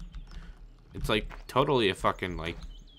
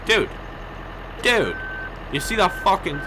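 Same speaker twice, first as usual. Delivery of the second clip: calling out loud to a skier; loud high yell; 10 semitones higher, 5 dB louder than usual. Real, not acted. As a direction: shout dude across the slope. Yelling at a skier.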